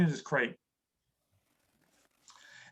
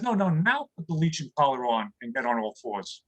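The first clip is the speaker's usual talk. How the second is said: dog giving pointers; 7 semitones lower than usual. Retold or imitated. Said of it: "He says".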